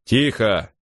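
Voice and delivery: restrained captain's bark; mildly barked command; barked order. A narrator's reading. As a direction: order silence sharply, without really shouting. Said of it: "рявкнул капитан Крози".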